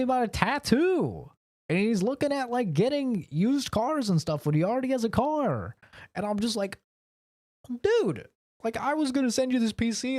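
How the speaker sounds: high-pitched, incredulous complaint; exasperated disbelief. Real, not acted. Griping about a friend who pleads no money, exasperated.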